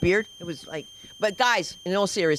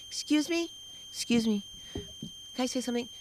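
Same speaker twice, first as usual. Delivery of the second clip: put-on soft little child's voice; interrupting daughter; 9 dB quieter than usual. Daughter cutting into mother's audition, soft polite interruption. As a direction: pipe up quietly and politely. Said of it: "I hear a voice say".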